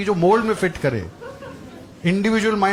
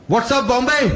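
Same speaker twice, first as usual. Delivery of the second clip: loud, high stage-opener hype; a lightly voiced eager comic; mock hype greeting; 7 semitones higher, 4 dB louder, slower than usual. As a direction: pump up the crowd like a cheesy emcee. Comic greets Mumbai crowd loudly.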